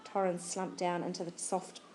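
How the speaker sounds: ordinary delivery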